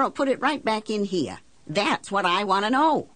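high-pitched, exasperated argument; indignant, demanding tirade; heated insistence. Acted. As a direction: press the banker hard for an answer. Argues heatedly with banker.

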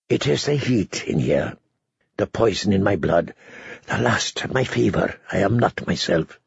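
fading as the heat takes him; faint, feverish. A narrator's reading. Jaime feverish, lightheaded in bath.

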